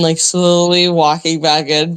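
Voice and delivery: normal talking voice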